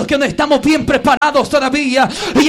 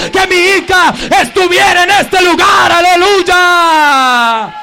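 full-force preaching; 2 semitones higher, 4 dB louder than usual. Real, not acted. Pastor voicing God's longing for his children, full-force preaching.

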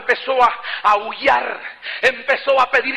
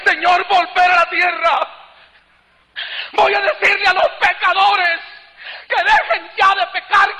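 high, crying vow to the Lord; retold sobbing prayer; tearful pleading; 7 semitones higher than usual. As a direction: plead through tears, voice pitched high. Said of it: "Mi llanto era continuo".

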